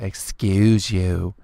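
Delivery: slow, haughty rising "Excuse you"; drawn-out stuck-up put-down; snotty "excuse you". Retold or imitated. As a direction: sneer it like a prissy girl. Repeating the girl's put-down.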